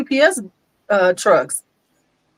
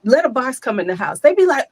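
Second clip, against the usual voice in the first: usual speaking voice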